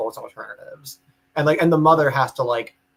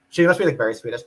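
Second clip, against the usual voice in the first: ordinary talk, nothing marked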